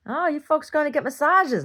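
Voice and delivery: high, loud, chirpy greeting; calling out to the couple in a high, loud voice; loud friendly call. Retold or imitated. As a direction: call out brightly to the passing couple. Greeting a young couple at the lot.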